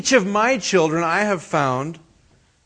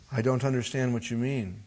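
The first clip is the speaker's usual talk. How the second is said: put-on softer, innocent child's reply; 5 semitones lower, 10 dB quieter than usual. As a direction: play dumb like a small boy. Son's reply voiced in story.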